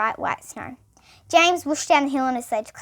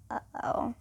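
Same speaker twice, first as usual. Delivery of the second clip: breathy whispered uh-oh; hushed narrator aside; 11 dB quieter than usual. A narrator's reading. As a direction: whisper it to the listeners. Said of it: "whispered aside".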